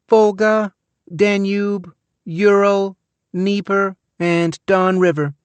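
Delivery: put-on small high girl's voice, rattling off answers; a bored kid reciting facts without looking up; child's voice. A narrator's reading. Child answering a quiz without looking up.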